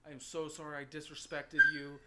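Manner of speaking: loud, over-sincere apology; hammed-up, pointed apology; exaggerated apology. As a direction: plead forgiveness loudly for show. Mock apology on his knees.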